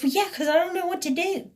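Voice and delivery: imitated quick, high-pitched comeback; exasperated retort. Woman defending her threat to call police, exasperated. Acted quarrel, her answer.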